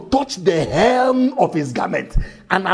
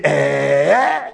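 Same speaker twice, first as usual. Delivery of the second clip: astonished eh; 5 semitones lower than usual. Acted. Woman's astonished reaction.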